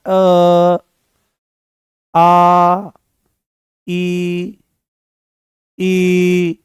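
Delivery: each vowel अ, आ, ई, उ held long and even; sustained vowels. Shown as a demonstration. Teacher leads class in held vowels to feel vibration. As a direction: hold each vowel steady so the throat hums. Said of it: "केवल अ बोलना है".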